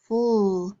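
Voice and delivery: FULL as a drawn-out "ful" ending; isolated syllable sound. Shown as a demonstration. Teacher drilling sound cards, FULL as a held syllable. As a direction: hold the end sound of ruffle, FULL. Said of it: "do our letter sound cards now".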